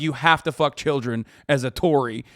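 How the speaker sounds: normal talking voice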